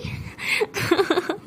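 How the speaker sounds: acted laughter. Jais cracks up at Sara, acted laughing. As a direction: burst out laughing at someone, acted. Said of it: "ज़ोर ज़ोर से हंसने लगती है".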